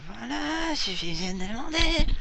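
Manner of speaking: high, loud sarcastic quip; mock exasperated. Gamer sarcastically greeting his viewers, high and loud. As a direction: throw it out like finally, at last.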